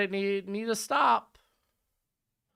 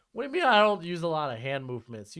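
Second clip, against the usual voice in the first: ordinary delivery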